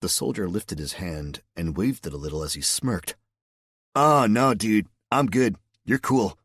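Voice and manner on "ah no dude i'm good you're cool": higher, louder laid-back SoCal surfer drawl; easygoing surfer guy, higher and louder; Southern California surfer. Acted. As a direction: play it like a chill California surfer dude. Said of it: "sounding like a pure Southern California surfer guy".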